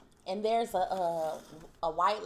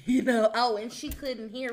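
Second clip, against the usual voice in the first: louder, laughing it off; 4 semitones higher, 7 dB louder than usual. Real, not acted. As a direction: laugh at yourself. Laughs at own ailments.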